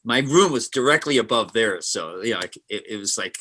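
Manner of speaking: ordinary talk, nothing marked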